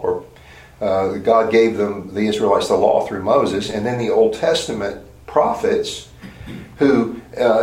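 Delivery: usual speaking voice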